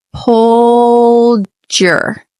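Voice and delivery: "Pulled your" stretched out very slowly as one linked sound; very slow exaggerated. Shown as a demonstration. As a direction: drag out "Pulled your" so the link is audible. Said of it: "You can connect those".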